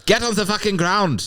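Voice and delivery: German paratrooper ordering the seller down; mock Nazi command; German soldier. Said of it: "then he goes".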